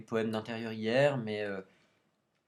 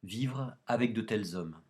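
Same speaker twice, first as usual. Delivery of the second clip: measured, solemn poem recitation; poetry reading. Acted. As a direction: recite line by line, pausing between phrases. Lit un poème de René Char. (récitation de poème, posée et solennelle)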